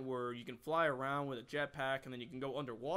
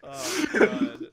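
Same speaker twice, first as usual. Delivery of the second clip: laughing; 14 semitones higher, 14 dB louder than usual. Real, not acted. Laughing hard.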